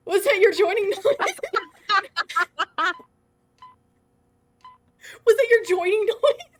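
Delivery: high, loud laughing question. Real, not acted. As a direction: laugh through the words. Laughing after a jump scare.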